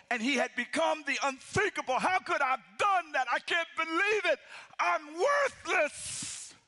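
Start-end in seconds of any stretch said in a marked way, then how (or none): 2.0-6.5 anguished remorse. Acted. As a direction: wail it out in self-disgust. Peter's remorse voiced by preacher.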